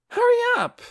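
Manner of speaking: put-on very high, loud girl's yell; shouting. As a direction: snap at him to hurry. Aura losing patience.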